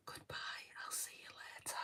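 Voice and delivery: hushed whispered goodbye. Shown as a demonstration. Saying goodbye to her sister in mock ASMR, whispering. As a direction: whisper a very soft ASMR sign-off. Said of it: "the tapping and the whispering".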